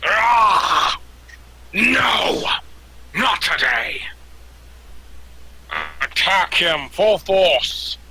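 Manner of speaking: loud, high battle cry; wounded captain roars out defiance; yelling. Acted. Game master as burned captain ordering attack, yelling. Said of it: "as he yells".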